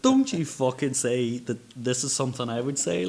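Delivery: loud, high, indignant retort; mock outrage. Telling the cleaner off in mock outrage, loud and indignant. Retold angry inner reaction.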